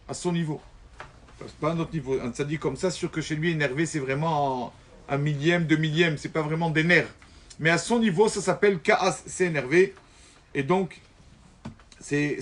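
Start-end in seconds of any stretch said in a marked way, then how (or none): none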